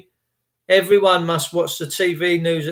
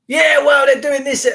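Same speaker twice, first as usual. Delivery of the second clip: mocking voice; 8 semitones higher, 5 dB louder than usual. Mimicking a news-obsessed friend.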